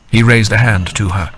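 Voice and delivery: ordinary delivery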